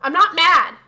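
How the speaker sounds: loud insistent denial; mock grumpy denial. Husband in the car insisting he's not mad, loud and grumpy. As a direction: bark the denial back. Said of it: "And then he goes".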